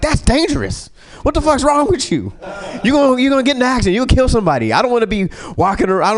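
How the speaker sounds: high, fast, frantic scolding; panicked passenger. Retold or imitated. Front-seat passenger freaking out at the girl, high frantic rant. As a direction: squeal it like a terrified guy in the front seat. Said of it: "the dude was like".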